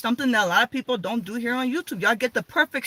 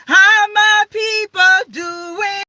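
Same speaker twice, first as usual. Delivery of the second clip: excited greeting; 9 semitones higher, 8 dB louder, much slower than usual. Real, not acted. Live stream opening greeting.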